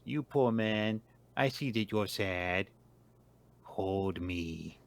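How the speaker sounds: soft, put-on creature voice; Bakugami's voice. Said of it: "Bakugami says".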